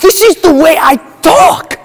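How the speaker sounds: indignant mock European accent; ridiculous accent. Villain defends his fake accent to brother, indignant European-accented outburst. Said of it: "why do you have this ridiculous accent".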